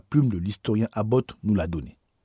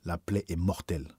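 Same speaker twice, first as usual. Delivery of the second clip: understated, softer murmur; murmure attristé; 3 semitones lower, 5 dB quieter than usual. A narrator's reading. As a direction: say it low and soft, a grave verdict barely murmured. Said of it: "en murmurant". (murmurer doucement, sans chuchoter)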